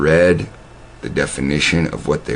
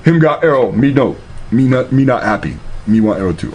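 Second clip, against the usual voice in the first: hammed-up primitive man sulking over an arrow; mock caveman whining for an arrow; mock caveman talk; 6 semitones higher than usual.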